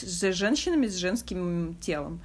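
ordinary delivery

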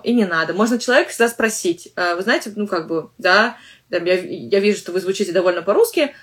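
ordinary delivery

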